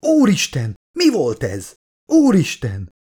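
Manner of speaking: high, startled cry; shrieking. A narrator's reading. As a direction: cry out in fright. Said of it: "Ford elsikította magát". (magas hangú, rémült felkiáltás)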